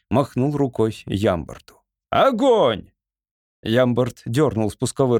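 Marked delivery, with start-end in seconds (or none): 2.1-2.9 high, falling battle command; commanding cry. A narrator's reading. Lord signals the gunner to shoot, commanding cry. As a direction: call out the order to fire.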